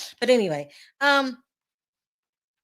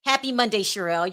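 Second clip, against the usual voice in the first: ordinary talk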